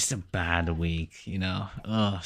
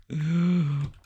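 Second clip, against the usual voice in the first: dramatic gasp; 9 semitones higher than usual. Pretend. Reacting to visa news.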